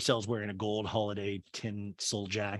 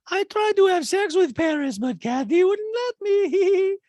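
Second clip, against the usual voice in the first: very high, loud falsetto; hammed-up falsetto, stretched out at the end; mock Diana; 8 dB louder, slower than usual. Parody of Diana's interview, in loud mock falsetto.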